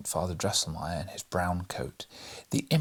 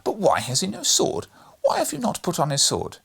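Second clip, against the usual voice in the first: put-on higher, insistent boy's demand; interrupting boy; 5 semitones higher, 5 dB louder than usual. A narrator's reading. Fred challenges his godfather about the sword, insistent interruption. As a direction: butt in, building to a louder, higher challenge. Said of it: "interrupted Fred".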